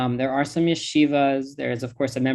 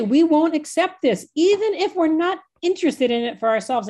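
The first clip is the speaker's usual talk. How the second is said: impassioned declaration; 12 semitones higher, 4 dB louder than usual. Retold or imitated. Passionate call for Israelis to speak.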